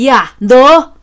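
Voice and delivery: loud furious yell of outrage; angry shout. Acted. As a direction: bark at him to stop. Father shouts at son.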